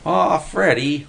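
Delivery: high, drawn-out whine; whining. A narrator's reading. Willie complains to Freddy, whining. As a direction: protest like a thwarted kid. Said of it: "Willie whined".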